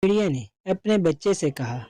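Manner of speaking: usual speaking voice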